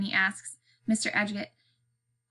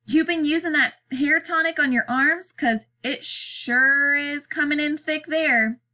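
loud taunt; 5 semitones higher, 4 dB louder than usual. A narrator's reading. Boy mocks a man's hairy arms, loud taunt. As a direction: tease him high, stretching the punchline. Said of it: "Bean says loudly".

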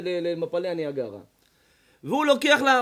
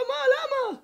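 surprised. Retold or imitated. Man surprised by warning.

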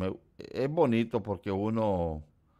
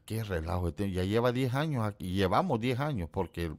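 normal talking voice

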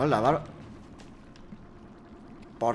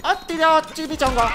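high-pitched fake Chinese accent; hammed-up Chinese-style chimichanga; mock Chinese; 9 semitones higher than usual. Shown as a demonstration. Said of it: "En chino".

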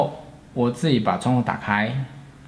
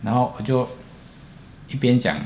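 ordinary talk

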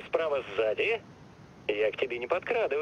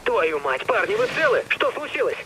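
shouting; 4 semitones higher, 4 dB louder than usual. Acted. Calling over explosions.

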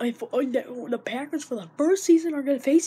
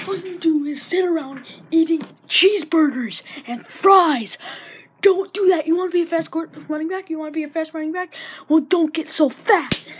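loud fast telling-off; scolding rant; 3 semitones higher, 9 dB louder than usual. Real, not acted. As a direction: chew him out. Scolding the player.

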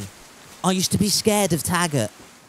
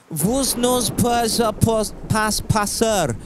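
slow, higher mock-French proclamation; mock French accent; 3 semitones higher, much slower than usual. Streamer plays Gandalf the French, mock French accent. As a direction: declaim it slowly in a hammed-up French accent.

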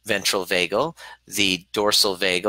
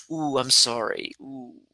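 mock sympathy; 4 semitones higher, slower than usual. Shown as a demonstration. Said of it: "the energy and the physiology of sympathy is".

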